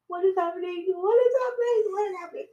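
high, near-tearful repeated gasps; overwhelmed disbelief. Retold or imitated. Guests agreeing to the podcast, overwhelmed high disbelief. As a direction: let it crack with happy disbelief. Retelling guests saying yes.

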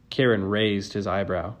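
plain reading voice

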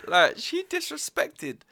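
mock outrage.

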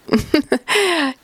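a short, high burst of laughter; hearty laugh. A narrator's reading. Marmot guest laughing at his own joke, high laugh. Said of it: "Nun lachten alle durch die Bank".

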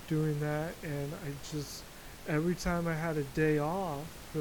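normal talking voice

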